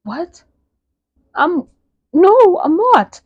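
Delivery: rising to a loud, high protest; outraged denial. Retold or imitated. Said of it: "she's like".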